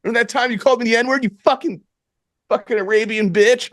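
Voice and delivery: high-pitched, hammed-up robber rant; mock angry threat. Imagining threatening the store clerk, mock angry rant. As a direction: spit out a mock stick-up threat.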